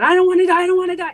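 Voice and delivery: high, loud pleading cry; desperate cry. Retold or imitated. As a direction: beg him to save you, loud and high. Running to stepfather, pleading.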